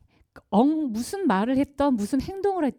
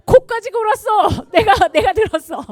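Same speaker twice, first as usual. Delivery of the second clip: laughing mockery; 11 semitones higher, 15 dB louder than usual. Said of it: "뭐랬냐면".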